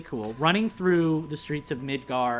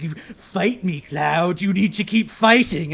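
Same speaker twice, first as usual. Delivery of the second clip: villain taunt; 3 semitones higher, 8 dB louder, slower than usual. Retold or imitated. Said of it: "showing up and be like".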